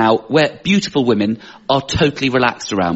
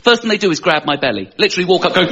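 ordinary delivery

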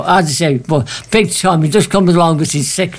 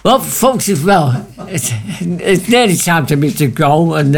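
ordinary delivery

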